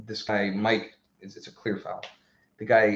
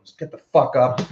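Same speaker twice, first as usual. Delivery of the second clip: sharp yell, higher and louder; yelled at the diver; 4 semitones higher, 6 dB louder than usual. Retold or imitated. Player yelling at a diving opponent to get up, loud. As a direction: bark it at the guy on the ground. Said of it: "yell in his face".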